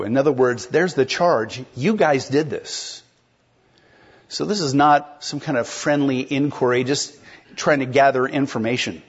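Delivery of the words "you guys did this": "You" slow and pointed, accusing; slow accusation, "You" stressed. Shown as a demonstration. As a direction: hit "You" like a charge: "You guys did this". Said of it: "they put the emphasis on you".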